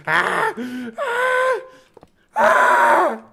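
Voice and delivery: high, strangled goat bleat; goat scream. Shown as a demonstration. Streamer mimicking goat sounds in frustration, screaming. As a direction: scream out short goat cries. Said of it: "You know those goat sounds they play?".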